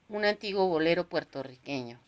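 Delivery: ordinary delivery, nothing marked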